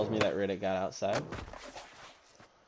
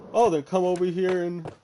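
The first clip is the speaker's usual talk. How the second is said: louder, higher imitated dare; retold challenge; 5 semitones higher, 4 dB louder than usual. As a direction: act out the neighbour goading for a fight. Neighbour daring speaker to fight.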